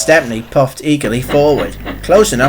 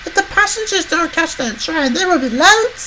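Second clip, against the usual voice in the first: high, softer female character voice; worried Emily; 3 dB quieter than usual. A narrator's reading. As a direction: fret quietly in a very high voice. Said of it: "she wondered".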